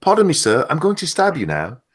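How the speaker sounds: mock posh English gent; polite upper-class British voice; posh British accent. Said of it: "they do not sound like that".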